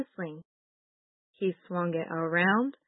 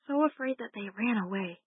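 ordinary delivery, nothing marked

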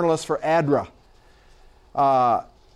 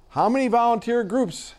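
raised-pitch call, no real volume; mild mock shout; 7 semitones higher than usual. A narrator's reading. Reporter questioning the director amid chaos, mildly mimicked shout. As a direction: pitch it up as if over noise. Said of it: "I shouted over the din".

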